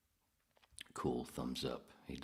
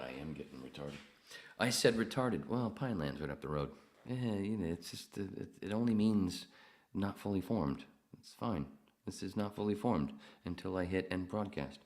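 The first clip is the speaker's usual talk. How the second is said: ordinary talk, nothing marked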